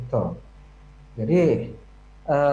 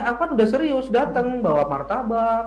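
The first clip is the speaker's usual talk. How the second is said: raised, louder imitation of an aggrieved suitor; mock protest; 5 semitones higher, 4 dB louder than usual. Suitor who brought snacks complaining, mock protest. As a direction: protest as the suitor, pitch lifted.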